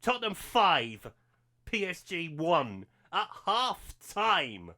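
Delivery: slow, spelled-out scoreline; said slowly. Real, not acted. Gamer announcing the half-time score to viewers, slowly savoured. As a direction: savour each word of the score. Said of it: "I'll say it slowly".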